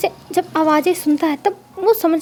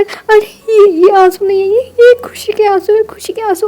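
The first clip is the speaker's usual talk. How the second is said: higher, louder through happy tears; tearful joy; 4 semitones higher, 7 dB louder than usual. Acted. Wing wiping tears, telling Wangji they're happy tears, tearful. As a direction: speak while wiping away tears of joy. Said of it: "आंसू पोंछते हुए".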